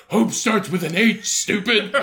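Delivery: teasing comeback on the edge of laughing; mocking retort. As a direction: deliver the punchline as a scornful correction. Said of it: "they start making fun of him".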